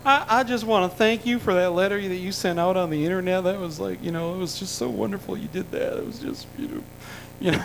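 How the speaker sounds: a gushing thank-you that fades away; Clinton voice. Retold or imitated. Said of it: "he goes".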